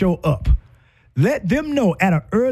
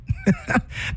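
brief high giggle; delighted little laugh; high soft laugh; 6 dB quieter than usual. Real, not acted. Speaker laughing softly at her own point.

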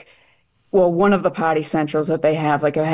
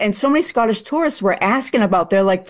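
ordinary delivery

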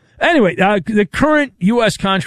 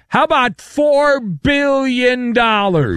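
slow and high, punching "four"; dramatic slow reveal of the price tag; big number reveal; 8 semitones higher, slower than usual. Acted. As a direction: lean hard on "four billion" for effect. Host teases a huge number.

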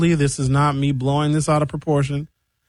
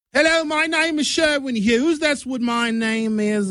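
high-pitched mock English accent; 10 semitones higher than usual. Shown as a demonstration. Demonstrating accent from the Great Britain area, English accent. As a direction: put on a high, exaggerated British accent. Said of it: "the English accent from England".

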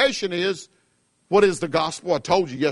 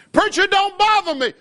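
high, loud whiny mock voice; hammed-up voice of a shrugging listener; 13 semitones higher, 4 dB louder than usual. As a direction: mimic the careless churchgoer, pitched up. Mocking the indifferent listener.

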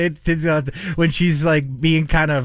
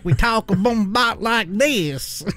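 mock Southern drawl; 3 semitones higher, slower than usual. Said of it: "that's like people saying".

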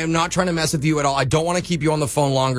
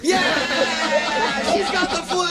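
high-pitched excited cheer; delighted high whoop; celebrating; 12 semitones higher, much slower than usual. Real, not acted. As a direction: cheer in triumph, voice way up. Callers celebrate pharmacist joining in.

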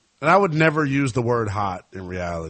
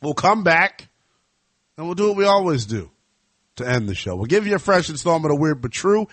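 ring announcer sign-off; 8 semitones higher, 4 dB louder than usual. Pretend. Host announcing a quick timeout, high announcer style. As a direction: send it to break like a ring announcer, stretching the last words.

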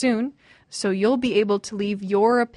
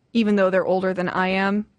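usual speaking voice

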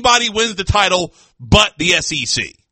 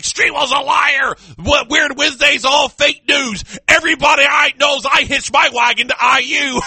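mock yelling; 6 semitones higher than usual. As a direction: ham up a colleague ranting on air. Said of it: "he started yelling over the radio".

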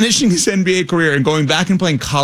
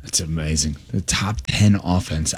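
deadpan awe; 13 semitones lower, 6 dB quieter than usual. Real, not acted. Mock awe at claim.

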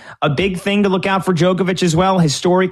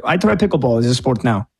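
lower, hammed-up Russian accent; cartoonish Russian accent quoting a tennis player; mock Russian accent; 5 semitones lower than usual. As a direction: put on a bad Russian accent, tongue in cheek. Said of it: "imitation of a Russian accent".

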